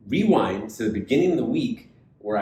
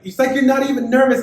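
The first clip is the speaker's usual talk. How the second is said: high, loud put-on voice; mock high voice; 10 dB louder than usual. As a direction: squeal it, nervous and teasing. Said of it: "And I was like".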